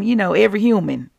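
ordinary talk

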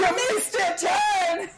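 slow, laughing Scottish shout; Scottish accent. Pretend. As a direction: yell at the driver in a Scottish brogue. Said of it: "my Scottish accent".

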